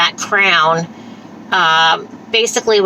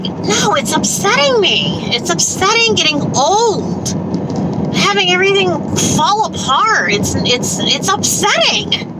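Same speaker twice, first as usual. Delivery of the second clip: upset; 10 semitones higher than usual. Real, not acted. Upset about aging.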